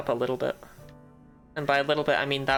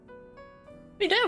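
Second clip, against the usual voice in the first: cute anime streamer; much slower than usual. Pretend. Slow high squeaky voice.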